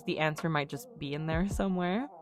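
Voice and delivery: usual speaking voice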